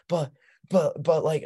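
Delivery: put-on high-pitched little-kid stammer; imitated protesting kid. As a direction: squeak out a child's flustered objection.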